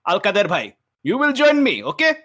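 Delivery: high, loud summons to a companion; rallying call. Acted. Character summons Al-Kader to join the escape, high and loud. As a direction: call him to your side.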